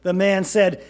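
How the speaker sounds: ordinary delivery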